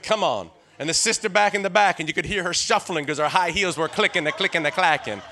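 loud, building church preaching; full-force preaching. Acted. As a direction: shout it to the back pews. Preaching about old-time church.

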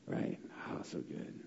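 breathy hushed aside; whispered so good; whispered delight. Real, not acted. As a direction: savor it under your breath. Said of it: "savoring the passage".

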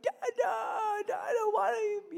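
high, loud mock toddler wail; crying child. Son following dad who avoids him, mock crying. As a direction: wail like a little boy left out. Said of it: "like, crying".

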